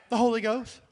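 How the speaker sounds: "The Holy Ghost" said out loud, stress on "Holy"; proclaimed answer. Real, not acted. Pastor proclaiming to the church, "The Holy Ghost" called out loud. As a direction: ring out "The Holy Ghost", punching "Holy".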